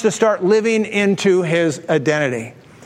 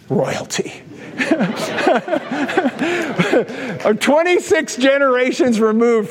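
laughing through it; 5 semitones higher than usual. Real, not acted. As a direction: let royalty collapse into a hushed giggle. Punchline dissolving in laughter.

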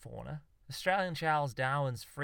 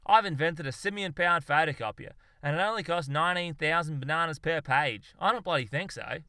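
raised, louder scornful announcement; mocking boast; 4 semitones higher, 6 dB louder than usual. Zoologist mocks a monkey photocopier, scornful boast. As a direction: parody a proud inventor, then dismiss it.

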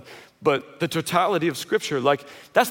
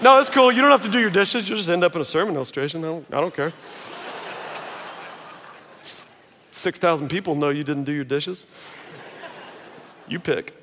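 passive aggressive. Retold or imitated. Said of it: "I'm kind of passive aggressive".

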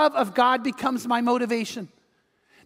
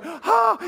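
high acted gasp of wonder; amazed gasp; 11 semitones higher, 3 dB louder than usual. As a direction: gasp as if seeing the surprise. Imitating her gasp at proposal.

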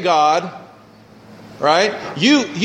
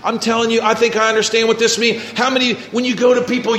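ordinary delivery

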